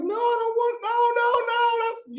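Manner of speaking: very high falsetto pleading; mock panic. Guest reacting to audience booing his mother, mock panic. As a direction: squeal it in comic alarm.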